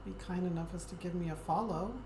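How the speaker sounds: normal talking voice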